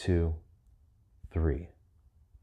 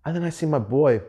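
ordinary talk, nothing marked

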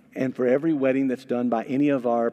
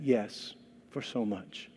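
quiet lie; 4 semitones lower, 8 dB quieter, slower than usual. Acted. Sapphira lying quietly.

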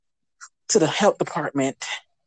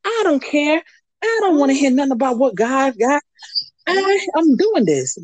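raised, high defiance; defiant; 7 semitones higher than usual. Retold or imitated. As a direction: push back hard, pitched up. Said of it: "I'm looking at her like".

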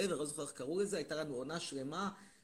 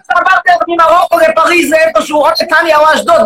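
shouting, indignant rant at the audience; loud mock rant; 9 semitones higher, 28 dB louder than usual. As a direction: bellow it like an outraged demagogue. Loud persona ranting.

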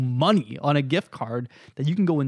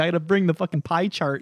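ordinary delivery

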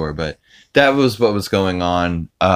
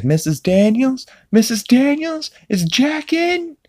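high, slow put-on Peter voice, asking politely; imitating Peter on phone. Dad on the phone asking for his friend Jack, high and slow. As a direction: squeak out a timid phone query for Jack. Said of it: "he's like".

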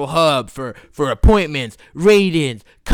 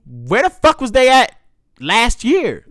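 louder, higher outraged question; indignant; 5 semitones higher, 6 dB louder, slower than usual. Retold or imitated. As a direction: demand it with irritation. Complaining to the VA caller.

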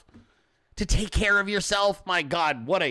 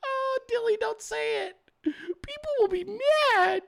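squeaky mock plea. Streamer teasing the chatter Dilly, squeaky mock plea. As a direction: tease them in a tiny high whine.

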